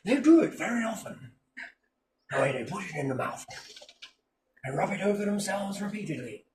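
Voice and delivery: soft, hushed nature narrator impression; whisper-close documentary narration; David Attenborough. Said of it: "narrate it as David Attenborough".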